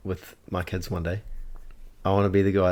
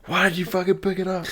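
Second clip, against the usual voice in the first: high-pitched squeal of mock outrage; imitated kids screaming; 11 semitones higher than usual. Kids blaming each other over the elf, imitated screaming. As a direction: squeal it like kids having a meltdown. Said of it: "screaming at each other".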